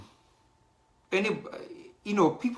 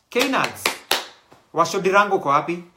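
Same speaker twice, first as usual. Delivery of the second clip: loud, raised hail; calling out; 4 semitones higher, 8 dB louder, faster than usual. Real, not acted. Host looking for Knads and Washo, calling out loudly. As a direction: shout out for someone missing. Calls out for absent people.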